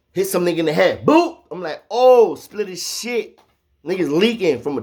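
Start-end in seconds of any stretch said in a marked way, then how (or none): 1.0-1.4 a sudden, very high, loud cartoon "boop"; mock impact noise. Imitating tray hitting a head. 1.9-3.4 high, slow, drawn-out shocked cry; shocked reaction. Retold or imitated. His own shocked reaction.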